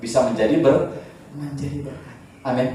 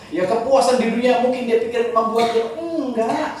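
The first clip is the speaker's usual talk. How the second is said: much higher, fervent sermon voice; preaching at full force; 11 semitones higher than usual. Acted. Preacher warning congregation about empty riches, fervent high preaching. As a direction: drive the point home to the crowd.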